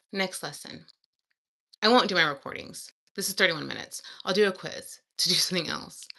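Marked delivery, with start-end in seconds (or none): none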